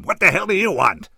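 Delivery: loud, high, furious demand; barked. A narrator's reading. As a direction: round on him with an angry bark. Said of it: "he barked".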